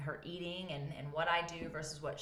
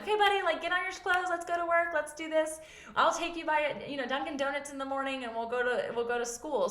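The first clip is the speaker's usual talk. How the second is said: higher, louder, pushy upbeat hurry; mock rushing mom; 11 semitones higher, 6 dB louder than usual. Mom hurrying sleepy son to get dressed, high loud upbeat push. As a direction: chirp it like you're herding him out. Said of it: "And I'm like".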